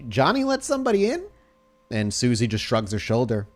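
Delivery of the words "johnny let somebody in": lightly voiced guard, pitched up; a hint of a suspicious guard; security guard.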